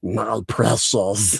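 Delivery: slow, drawn-out creature croon; hammed-up covetous creature impression; Gollum. Shown as a demonstration. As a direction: draw the words out in a creepy creature voice. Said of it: "I can do a Gollum".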